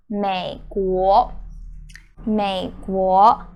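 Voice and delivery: 美国 said very slowly; slow demo. Shown as a demonstration. Teacher slowly modelling 美国 for the class. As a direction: stretch out 美国 for learners.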